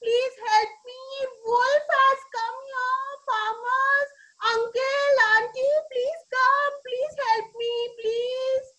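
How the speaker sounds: high, stretched distress call; sustained high-pitched mock cry for help; shouting for help. A narrator's reading. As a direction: call out to the farmers in pretend panic. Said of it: "again he started shouting".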